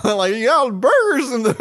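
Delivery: high, loud mock voice; Don Knotts. Host teasing co-host over burgers, high Don Knotts impression. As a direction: mimic an old comedian, cracking up. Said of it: "you sound like Don Knotts almost".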